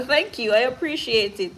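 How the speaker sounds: laugh, then thank them happily. Real, not acted. Streamer appreciates a viewer's roses, laughing.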